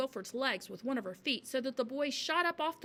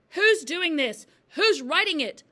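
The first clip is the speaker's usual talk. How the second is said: high, loud, shaken demand; yelling; 7 semitones higher, 12 dB louder, slower than usual. A narrator's reading. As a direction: yell at nobody in particular. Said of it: "she yelled at nobody in particular".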